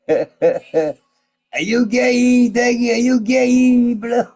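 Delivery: loud, high, slow taunting; rowdy drunken teasing, drawn out; belligerent drunk. Pretend.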